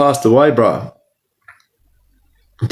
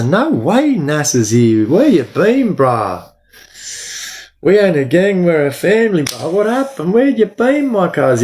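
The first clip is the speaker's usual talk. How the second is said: calling out happily to a mate who just showed up; excited greeting; 6 semitones higher than usual. Real, not acted. Streamer greeting a mate who joined the live, excitedly.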